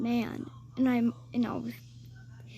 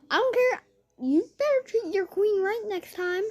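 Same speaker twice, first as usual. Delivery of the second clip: much higher, loud queenly scold; bossy high-pitched warning; high bratty scolding; 9 semitones higher, 4 dB louder than usual. Retold or imitated. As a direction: put him in his place like royalty.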